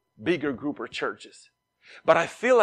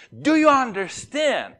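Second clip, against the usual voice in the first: higher, pointed teacher question; mock impatient teacher; 9 semitones higher, 4 dB louder than usual. Bible school teacher pressing a confused student, mock stern.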